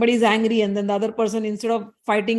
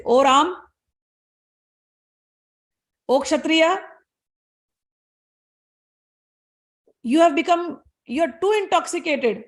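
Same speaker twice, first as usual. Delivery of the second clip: raised, higher scolding of a proud king; a sage rebuking the king, pitched up in anger; angry sage; 4 semitones higher than usual. Acted. Angry sage Parshuram scolds Ram, pitched up. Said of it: "he went on speaking all this thing in anger".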